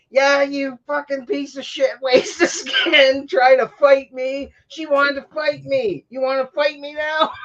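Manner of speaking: high-pitched, mock-furious rant; imitated angry yelling. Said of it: "he's yelling at her".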